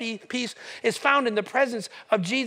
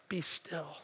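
low, soft command; calming hush; 7 semitones lower, 12 dB quieter than usual. Retold or imitated. Jesus to the crashing waves, low soft hush. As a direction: settle the waves gently.

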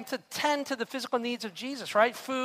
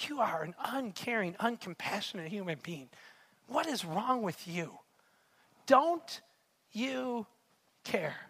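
reproachful; 6 dB quieter than usual. Acted. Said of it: "And she says".

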